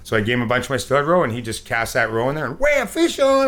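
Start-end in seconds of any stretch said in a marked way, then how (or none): 2.6-3.5 excited. Retold or imitated. Friend hooks fish.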